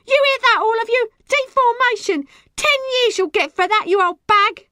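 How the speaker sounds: high, loud accusing shriek; outraged piercing tirade; screaming. A narrator's reading. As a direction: scream threats at your enemy. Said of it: "Rosie screamed".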